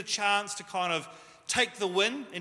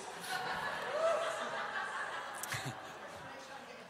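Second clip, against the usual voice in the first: high breathy laugh; 9 dB quieter than usual. Real, not acted. Laughs at own title.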